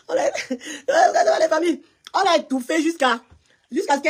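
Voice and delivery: high-pitched, laughing storytelling; cracking up mid-anecdote. Real, not acted. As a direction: struggle to tell it through real laughter. Laughing over own family anecdote.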